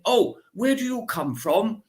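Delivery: high, plummy put-on voice, questioning lift; mock aristocrat's polite probe; lightly hammed-up posh inquiry; posh lady asking. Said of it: "And she said".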